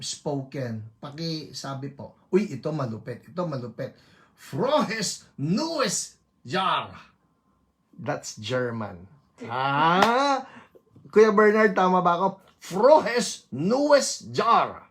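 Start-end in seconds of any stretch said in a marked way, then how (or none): 4.3-6.9 slow, drawn-out German greeting; German accent. Shown as a demonstration. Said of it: "Ba't nagbabago yung tono mo". 12.6-14.8 louder, slow German greeting; German accent. Shown as a demonstration. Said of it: "Ba't nagbabago yung tono mo".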